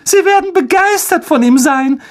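very high gushing aunt; enraptured cry. A narrator's reading. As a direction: coo over your son at piercing pitch. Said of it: "rief Tante Petunia ganz hingerissen".

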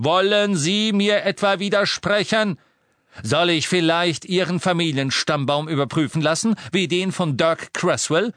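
threatening. Acted. Harry threatens the wizard.

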